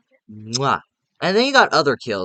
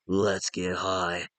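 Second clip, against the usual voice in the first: lower, softer, slow sinister villain line; Freddy voice; 5 semitones lower, 8 dB quieter, much slower than usual. Retold or imitated. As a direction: purr it slowly like a creepy killer.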